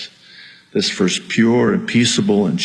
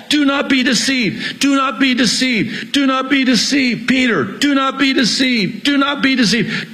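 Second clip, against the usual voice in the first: high ringing pulpit shout, repeated warning; yelling; 14 semitones higher than usual. Real, not acted. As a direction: cry the warning out again and again. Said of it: "I'm sorry, I'm yelling".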